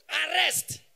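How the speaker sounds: loud, emphatic mock command; hammed-up shouted decree; mock military order. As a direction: call it out like a dictator's order. Acted military ruler giving orders.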